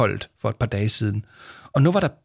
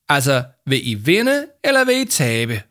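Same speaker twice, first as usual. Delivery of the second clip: called out loud over the shoulder; shouting; 4 semitones higher, 5 dB louder than usual. A narrator's reading. Victoria calling back to Arthur and Yama while leaving, shouting. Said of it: "råbte hun over skulderen".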